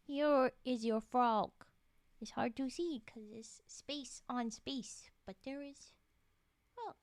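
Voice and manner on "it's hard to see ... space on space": normal talking voice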